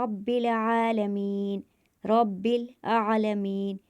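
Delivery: रब्बिल आलमीन chanted slowly, the आ of आलमीन held one alif's length; slow and chanted, stretch आ; slow Quranic recitation. Shown as a demonstration. Teacher reciting रब्बिल आलमीन slowly, vowel stretched. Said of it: "एक अलिफ के बराबर खींचकर पढ़ेंगे".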